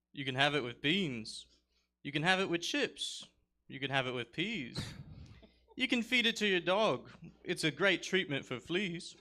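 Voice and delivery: put-on hammy British accent, rhyming ditty; terrible British accent. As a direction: recite it in a bad British accent.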